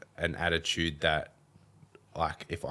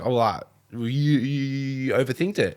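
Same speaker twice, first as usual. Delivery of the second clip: high, louder mocking stammer; mocking mum; 7 semitones higher, 5 dB louder than usual. Mum mimicking son's excuse: high mocking stammer. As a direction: parrot his fumbling back at him. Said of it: "she's like".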